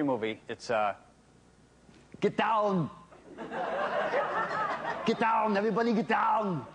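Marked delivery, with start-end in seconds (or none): 2.2-2.9 high loud action-hero shout; Arnold Schwarzenegger impression. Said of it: "He always says". 5.0-6.6 high loud action-hero shout; Arnold Schwarzenegger impression. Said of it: "He always says".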